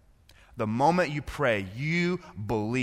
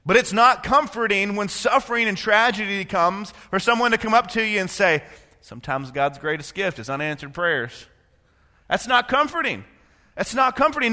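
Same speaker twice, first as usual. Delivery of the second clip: higher, louder urgent conviction; impassioned preaching; 10 semitones higher, 7 dB louder than usual. Acted. Pastor rebuking empty comfort, fervent. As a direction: drive home the point.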